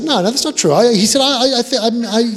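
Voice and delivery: high, loud stuttered denial; high, loud mock stammer; caught-out guest. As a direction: deny it in a fluster.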